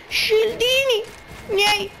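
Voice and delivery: high-pitched excited squeal; excited calling out. Real, not acted. Gamer grabbing shields mid-match, high excited cry. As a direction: claim it greedily, pitch way up.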